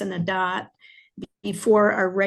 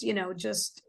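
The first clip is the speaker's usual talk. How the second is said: normal talking voice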